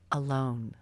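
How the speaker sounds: ordinary delivery, nothing marked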